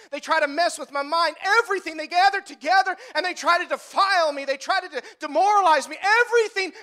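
David's desperate cry for help, building to a shout. Acted. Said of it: "David is crying out to God".

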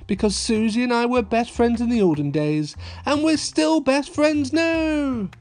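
put-on little girl voice. A narrator's reading. Peppa's closing line.

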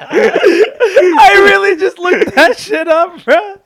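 high, slow, laughing through the words; laughing hard. Real, not acted. Friend laughing at his own bad joke while telling it. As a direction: crack up at your own lame joke.

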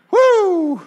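high, loud laughing whoop. Real, not acted. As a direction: whoop with delight at the chaos. Demon hits floor, laughing.

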